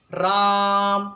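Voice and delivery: राम pulled out like a chant; drawn-out ram. Shown as a demonstration. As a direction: stretch राम very slowly. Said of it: "खिंच रही है".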